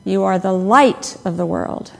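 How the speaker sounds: "light" punched out, then falling away; stress on LIGHT. Shown as a demonstration. Preacher recalling a childhood word game, stress on "light". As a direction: lean hard on "light". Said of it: "and so on".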